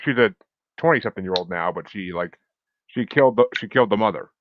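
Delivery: ordinary delivery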